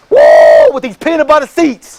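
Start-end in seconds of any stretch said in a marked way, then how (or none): 0.1-0.7 excited whoop. Real, not acted. Hyping the dream car.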